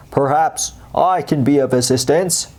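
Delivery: smooth, sly crocodile voice; a lightly voiced slippery crocodile; oily crocodile. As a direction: ooze false helpfulness. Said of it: "particularly oily voice".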